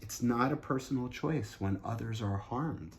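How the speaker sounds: ordinary talk, nothing marked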